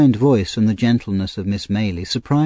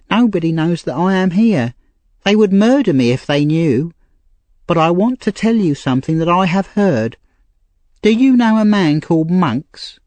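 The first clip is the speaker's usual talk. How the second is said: put-on higher, pleading young woman; Nancy, street girl; 8 semitones higher than usual. A narrator's reading. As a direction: voice a desperate young thief girl. Nancy voiced.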